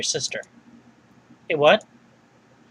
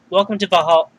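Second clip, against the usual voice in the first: ordinary talk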